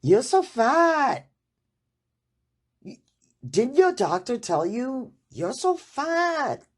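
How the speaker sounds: put-on blunt Hong Kong-accented doctor telling it straight. Old school doctor telling her patient off, Hong Kong accent, high and loud. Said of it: "with her Hong Kong accent".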